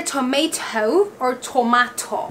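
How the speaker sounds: TOMATO said "to-MAY-toe", then "to-MAH-toe"; hard second T. Shown as a demonstration. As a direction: hit both Ts of TOMATO, with full O vowels. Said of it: "So it's either".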